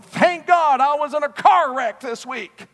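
mocking a believer who thanks God for a car wreck; mock cheerful thanks. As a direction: gush it as absurd false gratitude. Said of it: "God doesn't want you going".

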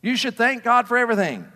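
preacher sneering at TV preachers with a loud mock sermon. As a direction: mimic a TV preacher working the crowd. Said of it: "you'll hear them preaching".

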